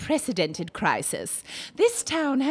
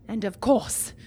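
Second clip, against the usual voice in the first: ordinary talk, nothing marked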